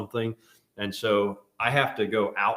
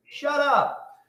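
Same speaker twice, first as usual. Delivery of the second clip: high-pitched grumpy cat; mock cat retort, pitched way up; translated cat voice; 3 dB louder than usual. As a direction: snap back as an annoyed cat. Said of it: "I can translate for him".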